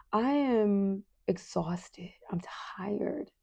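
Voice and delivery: worn-out retelling, slow and fading soft; exhausted. Retold or imitated. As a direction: let it sag into a near-whisper. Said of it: "the tears begin to just roll".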